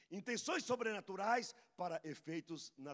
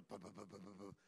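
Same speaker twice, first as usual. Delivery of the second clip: soft mimicked clicking; 8 semitones lower, 10 dB quieter than usual. Shown as a demonstration. Brain working, imitated.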